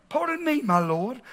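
soft mock-British politeness; badly faked English accent. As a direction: quietly put on a hammy British voice. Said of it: "I hear it in an English accent".

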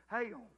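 "Hail." drawn out into two syllables, hay-ul; Southern two-syllable hell. Shown as a demonstration. Pastor demoing how the South says hell, two syllables. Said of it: "hell has two syllables".

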